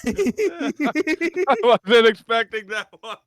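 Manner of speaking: laughing hard, high and loud; can't stop laughing. Real, not acted. Laughing at the joke.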